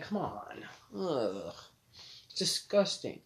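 ordinary delivery

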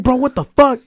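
high, loud freaked-out reaction; loud, astonished outburst; shocked disbelief. Retold or imitated. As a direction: exclaim it, pitch shooting way up. Acting out own shocked reaction.

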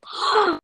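loud sharp mock gasp; shocked gasp. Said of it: "she'd be like".

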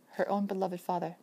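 plain reading voice

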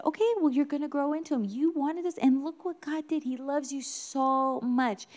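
put-on high, encouraging parent-to-child voice; consoling parent. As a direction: cheer up a disappointed little one.

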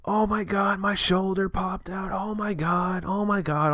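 imitating his own high panicked moaning; retold panic. Man on the floor after his shoulder pops, imitated high panic.

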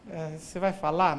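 low, hesitant mother-in-law; embarrassed. Retold or imitated. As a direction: imitate an awkward, reluctant question. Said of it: "ela falou de um jeito constrangido".

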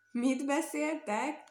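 high, slow mock shout from the turtle. A narrator's reading. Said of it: "kiáltotta". (lassú, felemelt hangú kiáltás)

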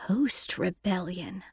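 slow, soft mutter; muttered under breath. Acted. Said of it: "Miz Chapman muttered under her breath".

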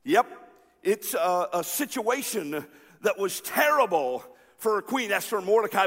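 ordinary talk

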